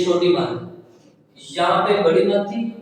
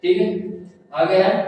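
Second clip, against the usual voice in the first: ordinary delivery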